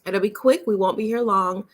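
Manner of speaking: normal talking voice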